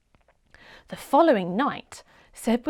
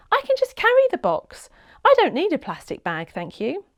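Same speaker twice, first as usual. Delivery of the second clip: put-on child voice; 7 semitones higher, 4 dB louder than usual. A narrator's reading. Child Seb declines bag.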